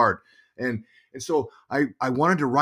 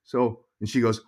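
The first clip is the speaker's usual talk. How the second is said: usual speaking voice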